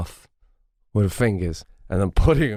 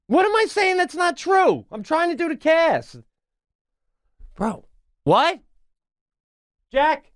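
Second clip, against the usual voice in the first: calling out. Real, not acted. Host calling out to his wife who overheard him. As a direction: call out to her, defensive.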